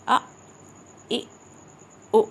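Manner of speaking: "آ" cut off abruptly by a glottal stop; very short "آ", jolted shut in the throat; hamza jolt. Shown as a demonstration. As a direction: stop each short vowel like "آ" with a jerk. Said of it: "جھٹکا آتا ہے".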